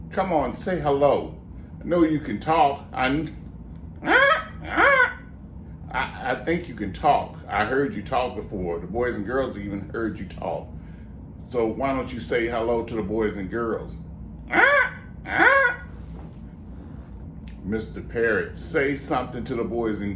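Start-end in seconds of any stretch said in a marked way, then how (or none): 4.0-5.2 a squawking bird laugh, very high; high parrot squawk laugh. Acted. Ventriloquist's parrot dummy squawks. 14.5-15.8 very high squawking parrot. Acted. Ventriloquist's parrot dummy squawks.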